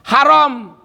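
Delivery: "Haram" with a breathy Arabic h and a rounded, open a; correct Arabic pronunciation. Shown as a demonstration. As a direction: stress "Haram" with a throaty h and an o-like vowel, harom. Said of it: "bacanya haram".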